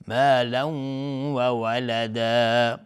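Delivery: slow مالا و ولدا, nasal hum merged into the w; merging with ghunna. Shown as a demonstration. Teacher demonstrating idgham, مالا و ولدا joined with a long nasal. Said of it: "تنوین بعدش واو ادغام با غنه".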